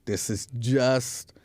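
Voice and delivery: low, calm pretend reassurance; soothing reassurance. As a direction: gently placate your partner, voice dropped low. Reassures partner during imagined foot rub.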